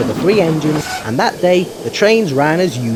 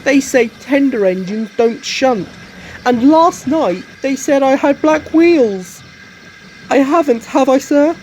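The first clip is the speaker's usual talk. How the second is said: sad; 11 semitones higher, 6 dB louder than usual. Acted. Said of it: "answered Edward sadly".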